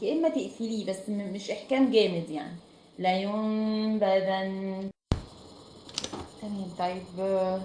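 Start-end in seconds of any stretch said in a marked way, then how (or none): 3.0-4.9 لا ينبذن with the n turned to m and a two-count hum; very slow Quran recitation, nasal hold; iqlab with ghunna. Shown as a demonstration. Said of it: "اقفليه أكتر من كده".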